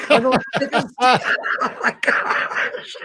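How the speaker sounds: high, breathless laughing speech; words swallowed by real laughter, drawn out; laughing too hard. Real, not acted. Co-host cracking up at the joke, laughing too hard to talk.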